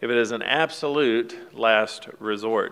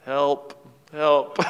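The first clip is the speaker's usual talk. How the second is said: small, meek cry for help, half-hearted; a sheepish little plea, mock; 3 semitones higher than usual. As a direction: son dangling from the cliff, sheepishly pleading.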